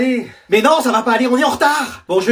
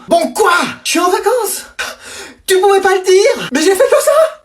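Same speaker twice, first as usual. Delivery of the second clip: exasperated yell; 10 semitones higher than usual. Acted. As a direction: blurt it out at mom, fed up. Exasperated kid yelling at mom.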